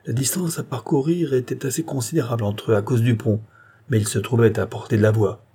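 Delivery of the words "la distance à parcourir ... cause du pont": ordinary delivery, nothing marked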